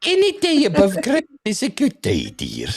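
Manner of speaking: high, laughing Scottish granny voice. Shown as a demonstration. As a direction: squeak it like a wee Scottish granny. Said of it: "You've gotta do it in the granny voice".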